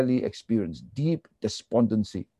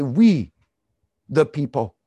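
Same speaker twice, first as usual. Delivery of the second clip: "We" louder and drawn out, then a pause; stress on WE; 3 semitones higher, 5 dB louder than usual. Shown as a demonstration. Preacher showing the psalmist's omitted verb, emphatic stress on "We". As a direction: punch "We" hard, let "the people" follow. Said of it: "to strengthen the emphasis".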